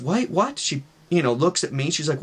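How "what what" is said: high, questioning imitated mother; puzzled little mock outburst; baffled mom. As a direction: react in acted confusion. Mom baffled by child's accusation.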